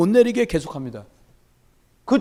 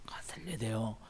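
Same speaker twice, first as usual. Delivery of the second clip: whisper; 12 semitones lower, 20 dB quieter than usual. Real, not acted. Sudden whisper mid-sermon.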